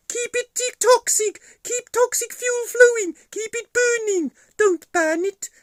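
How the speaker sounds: very high, loud squeaky puppet voice; puppet villain pleading. Pretend. Puppet warning Buzz to keep toxic fuel flowing, high frantic pleading.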